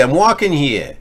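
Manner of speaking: New York accent, a notch calmer on the repeat of "I'm walking here". Shown as a demonstration. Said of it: "with a New York accent?".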